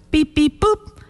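high spoken dialing beeps; mimicked phone beeps. Host imagines Laura dialing her grandma, high mimicked beeps. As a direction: voice little high electronic beeps. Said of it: "if she right now is like".

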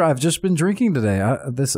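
normal talking voice

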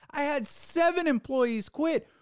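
high, self-pitying mock whine on seven; a sarcastic high-pitched mock victim.

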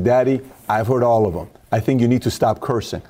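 normal talking voice

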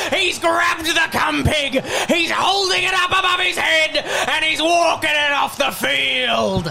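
excited sports-call holler; triumphant play-by-play. Acted. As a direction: stretch every word for the big moment. Commentator calls a triumphant moment.

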